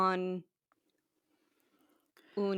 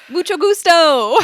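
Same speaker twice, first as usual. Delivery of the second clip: much higher, loud laughing greeting; laughing mock-Spanish hello, pitched high; cheery tourist Spanish; 11 semitones higher, 15 dB louder than usual. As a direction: beam out a goofy greeting. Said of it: "And you'll be like".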